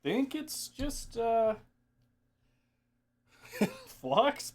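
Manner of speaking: high, laughing voice; laughing squeak. Real, not acted. Grossed out by crusty flux.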